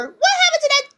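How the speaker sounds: high, faux-innocent question; mock them with a squeaky innocent voice. Streamer mocking viewers, squeaky faux-innocent question. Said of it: "you guys are always going to be like".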